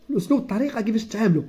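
usual speaking voice

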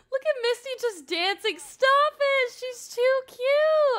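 high, loud squeal of delight; gushing excitement. Real, not acted. Player watching her game character dance, gushing squeal. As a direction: squeal over something adorable.

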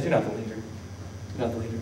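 very soft, low pronouncement; quiet verdict. Retold or imitated. God removing Saul as leader, quiet low verdict.

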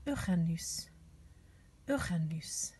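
"Uranus" said the French way, ü-ra-NÜS; French pronunciation. Shown as a demonstration. Teacher giving children the French planet name, "Uranus" pronounced ü-ra-NÜS. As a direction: model "Uranus" with throaty French r. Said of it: "names of the planets in French".

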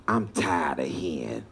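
put-on deep, slow grumble of an old man sick of it; grumbling old man. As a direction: drag it out low like a fed-up old man.

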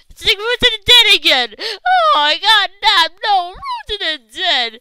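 high, very loud outcry; real high-pitched rant; exasperated yell. Real, not acted. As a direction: yell it in disbelief at the game. Game player yelling in shock.

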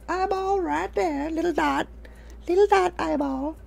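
tiny cartoon voice naming the eyeball; cutesy high voice. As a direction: coo it like talking to a baby.

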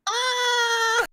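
high drawn-out whale cry; whale impression. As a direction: call out like a singing whale. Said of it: "That does not sound the same".

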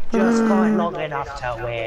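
slow, grand movie-hero line; heroic commander voice. Pretend. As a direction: deliver it like an epic trailer. Heroic slogan, slowed and far.

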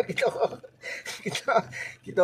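words broken up by real laughter; laughing. Real, not acted. Sailor admitting the crew enjoyed it, laughing as he talks. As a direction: laugh through the false starts. Laughs while recalling crew's fun.

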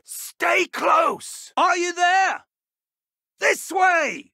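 high, loud shouts across a distance; calling out. Acted. Professor calling to a lost student nearby, shouting high. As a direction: call out to keep them near.

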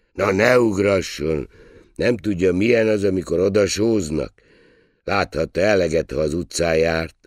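exasperated waiter who suspects teasing; rough-and-ready waiter, irritated. A narrator's reading. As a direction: retort loudly, pitch pushed up. Waiter's figure voice.

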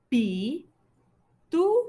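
Pitufo split into PI, TU, FO with long pauses; teacher sounding out "Pitufo" in three separate beats; syllable by syllable. Shown as a demonstration. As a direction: say "Pitufo" one slow syllable at a time. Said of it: "tiene la sílaba fo al final".

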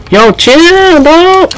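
squealed, stretched-out plea; high-pitched protest. Real, not acted. Player begging the opponent to ease off, squealing.

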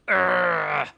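loud strained attack grunt; effort grunt. Pretend. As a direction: grunt as you strike.

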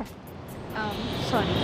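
mumbled under the breath, almost voiceless; a grudging sorry you can hardly hear; barely audible sorry. Real, not acted. Made to apologise, she mumbles a grudging sorry. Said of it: "सुना नहीं मेरे को".

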